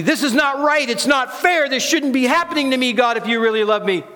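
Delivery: high, fast, aggrieved complaint to God; protesting worldly voice. Acted. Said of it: "My worldly inner voice is telling me".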